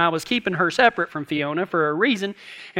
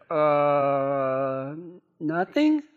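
drawn-out uh, then nothing rising innocently; evasive nothing; 6 semitones lower, 3 dB quieter, much slower than usual. Retold or imitated. Wife dodging husband's "what are you doing", evasive. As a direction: imitate a wife dodging, long hesitant uh. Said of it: "that scares me whenever my wife says".

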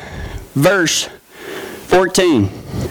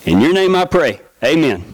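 prayer; 10 semitones lower than usual. Acted. Closing prayer.

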